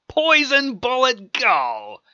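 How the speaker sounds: high, drawn-out battle cry; called-out attack. Acted. Hero firing his bullet, calling out the attack. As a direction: shout it like a special move.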